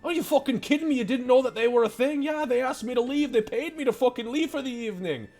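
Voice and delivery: exasperated butcher. Acted. Butcher learns of hole in his basement, exasperated rant. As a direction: rant in high-pitched disbelief, building. Game master voices the butcher.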